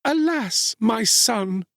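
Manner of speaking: very high woman's lament; anguished cry. A narrator's reading. Rejected mother to her son, crying out. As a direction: cry out in dismay, pitched high. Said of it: "she cried".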